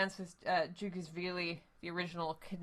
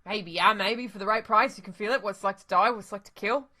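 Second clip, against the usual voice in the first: a slick hustler, lightly voiced; street dealer; 7 dB louder than usual. Dealer selling lab access to the runner, eager and loud. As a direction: pitch the lab like a sales spiel.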